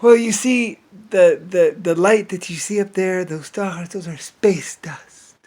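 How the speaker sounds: high pompous voice whispering space dust at the end; mock lecturer. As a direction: explain it like a smug know-it-all. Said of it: "it amounted to".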